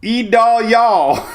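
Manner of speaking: loud, high hillbilly drawl on E Dahl, y'all. Pretend. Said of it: "That's a redneck name".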